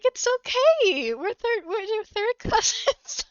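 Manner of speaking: hammed-up attempt to smooth it over; reassuring boyfriend. Boyfriend tells shocked women it's fine, mock reassuring. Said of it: "he's the one who's like".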